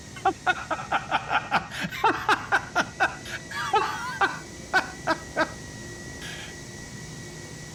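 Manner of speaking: high, breathless laughing fit; wheezing laughter. Real, not acted. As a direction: crack up into squeaky wheezes. Cracks up at the plane's tail.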